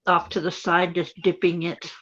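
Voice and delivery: ordinary talk, nothing marked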